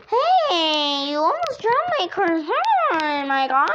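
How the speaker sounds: high loud goofy guy voice; cringy voice. Pretend. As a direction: ham up a silly dude. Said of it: "my cringy voice".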